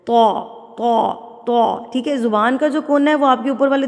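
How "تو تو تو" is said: تو with lips rounded and gathered; emphatic تو, lips pushed forward; pouted heavy ṭo. Shown as a demonstration. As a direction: pout and say a heavy تو. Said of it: "اکٹھا کر کے ہونٹوں کو".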